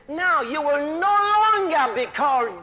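loud preacher's proclamation; full-force preaching. Acted. Preacher voicing the Lord renaming Jacob, loud proclamation. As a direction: proclaim the new name with force.

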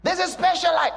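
mock philosopher. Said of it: "Philosophers talk like that".

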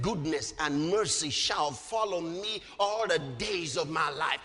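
low, even recitation; measured scripture quote. Retold or imitated. Preacher quoting David to congregation, measured recitation. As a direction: recite the verse low and steady.

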